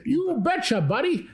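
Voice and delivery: very high, sing-song Wisconsin "you betcha"; Wisconsin accent. Retold or imitated. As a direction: ham up a Midwest Wisconsin twang. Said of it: "He's from Wisconsin".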